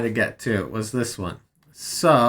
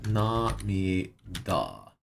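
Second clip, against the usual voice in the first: drawn-out, low and soft namida; quiet, slow puzzling; slow sounding out; 6 semitones lower, 9 dB quieter, much slower than usual. Shown as a demonstration. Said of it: "What is namida?".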